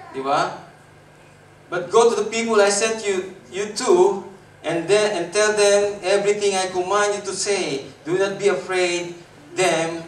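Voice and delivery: loud preaching recital of the verse; scripture recital. Acted. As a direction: proclaim God's words to the church. Rereads scripture. (malakas na pagbigkas ng salita ng Diyos)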